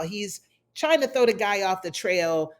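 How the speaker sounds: ordinary talk, nothing marked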